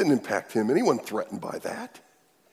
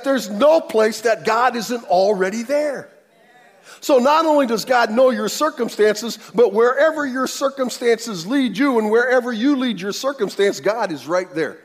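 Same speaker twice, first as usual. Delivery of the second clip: loud, higher fervent preaching; preaching at full force; 10 semitones higher, 7 dB louder than usual. Real, not acted. Pastor urging the congregation, full force preaching. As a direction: drive the point home to the church.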